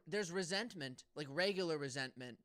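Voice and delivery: normal talking voice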